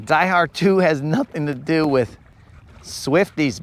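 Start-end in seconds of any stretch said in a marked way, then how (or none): none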